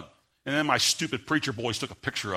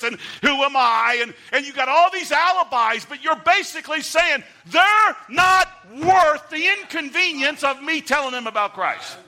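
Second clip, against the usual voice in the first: fiery preaching; 10 semitones higher, 7 dB louder than usual. Acted. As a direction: hammer it home to the congregation at full force. Preacher at full force.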